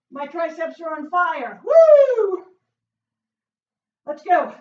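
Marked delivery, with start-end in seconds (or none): 1.6-2.5 long, high, loud woo; loud whoop. Real, not acted. Trainer whoops through burning triceps, loud whoop.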